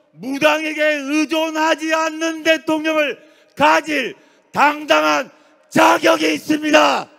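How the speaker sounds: high, loud oratorical climax; rousing shout. Real, not acted. Orator declaring the people's right to the crowd, rousing shout. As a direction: drive it up into a ringing shout.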